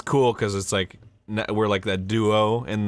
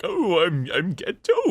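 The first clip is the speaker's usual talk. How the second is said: higher, whiny almost-crying voice; whiny Gato; 7 semitones higher, slower than usual. Shown as a demonstration. Voice actor showing how Gato now sounds, higher and whiny. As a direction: introduce yourself as if about to cry. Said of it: "he always kinda sounds like he's crying".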